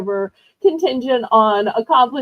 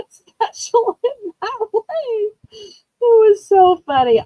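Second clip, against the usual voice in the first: laughing; 10 semitones higher than usual. Real, not acted. Laughing while telling.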